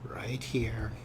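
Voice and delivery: unvoiced conspiratorial whisper; sly whisper. Real, not acted. Gamer whispering about easy loot. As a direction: tempt the audience in a hush.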